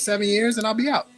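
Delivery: ordinary talk, nothing marked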